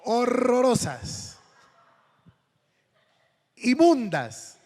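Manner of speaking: horrorosas and inmundas stretched slowly, high and loud; drawn-out disgust. Real, not acted. Preacher telling his congregation about the ugly shoes, slow loud disgust.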